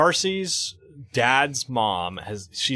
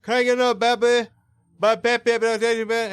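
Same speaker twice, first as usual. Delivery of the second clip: imitating the deaf lady; 7 semitones higher than usual. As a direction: lightly voice the deaf lady asking for Pepsi. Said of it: "talking to me like".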